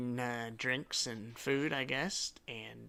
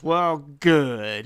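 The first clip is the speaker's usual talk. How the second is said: sardonic approval; 3 semitones higher, 10 dB louder than usual. Acted. Detective mocks the would-be heroes.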